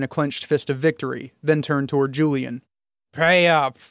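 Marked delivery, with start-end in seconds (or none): 3.1-3.8 slightly slurred demand; a tipsy man demanding payment. A narrator's reading. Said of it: "he demanded in a slightly slurred voice".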